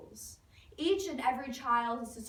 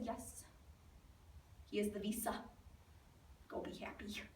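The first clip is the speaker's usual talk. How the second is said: very soft, deadpan giving in; small resigned acted reply; hushed resignation; 2 semitones lower, 13 dB quieter than usual. Mother's resigned reply to son.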